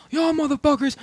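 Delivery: high-pitched retold challenge; mock yell without extra volume; angry call-out. Storyteller banging on his friends' door, angry call-out. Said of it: "a little angry".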